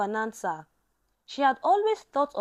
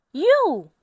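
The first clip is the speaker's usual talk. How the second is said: a sudden loud, higher "You!"; startled shout; 5 semitones higher, 8 dB louder than usual. Acted. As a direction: bark it out at the intruder. Bukola confronts the threatening man.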